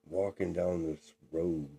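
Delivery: normal talking voice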